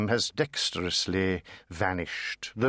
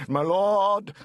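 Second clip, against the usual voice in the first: loud, high appeal to the judge; a man crying out from the back of the court; 9 semitones higher, 4 dB louder than usual. Acted. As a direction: call out desperately across the court. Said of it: "The man from Shropshire cries".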